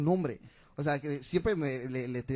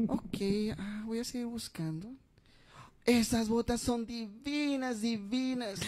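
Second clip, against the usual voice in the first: higher, slow gushing voice; effeminate customer; 8 semitones higher, slower than usual. Retold or imitated. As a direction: swoon over the boots. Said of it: "medias afeminadas".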